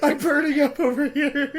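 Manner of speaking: high, tearful real laughter; laughing helplessly. Real, not acted. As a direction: squeal it through the giggles. Player helpless with embarrassed laughter.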